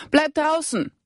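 high, urgent call to stay away; calling out a warning. A narrator's reading. Rebecca warns Siving to stay outside, calling high. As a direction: shout the warning out the door. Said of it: "rief Rebecca zurück".

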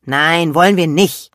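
loud, sulky refusal; girl angrily refusing her mother's offer; angry shout back. A narrator's reading. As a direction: snap it back crossly. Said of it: "rief Lilian böse zurück".

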